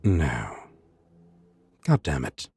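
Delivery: ordinary delivery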